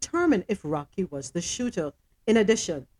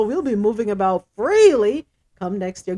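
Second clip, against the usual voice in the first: ordinary talk, nothing marked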